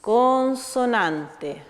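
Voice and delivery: consonante said very slowly, syllable by syllable; slow, careful naming of the term "consonante"; drawn-out term. Shown as a demonstration. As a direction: spell out "consonante" slowly for children. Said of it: "una rima que se llama".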